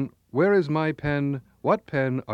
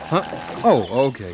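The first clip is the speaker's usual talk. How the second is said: normal talking voice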